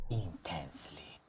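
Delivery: breathy whispered word. A narrator's reading. Narrator whispers to build the tension. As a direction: drop to a whisper.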